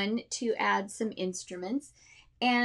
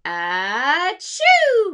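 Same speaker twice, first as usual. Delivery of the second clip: loud, much higher sneeze sweeping up; low to high sneeze; 7 semitones higher, 12 dB louder than usual. Shown as a demonstration. Teacher showing students the low-to-high sneeze. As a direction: slide the achoo from low to high. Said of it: "like, a low to high".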